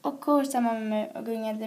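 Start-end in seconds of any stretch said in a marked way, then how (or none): none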